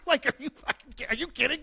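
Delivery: high, incredulous mock outrage; hammed-up are-you-kidding-me; mock disbelief. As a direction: play up the disbelief at the price. Retelling outrage at roof price.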